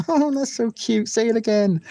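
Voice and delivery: put-on delighted American girl cooing at the accent; gushing American girl. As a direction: squeal it like a charmed American girl. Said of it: "they think it's delightful".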